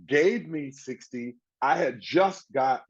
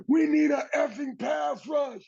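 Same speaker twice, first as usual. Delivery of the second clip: high, slow mock yell; retold shout. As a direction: bark it in the huddle. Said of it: "say things like".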